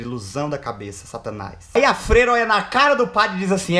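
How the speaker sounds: usual speaking voice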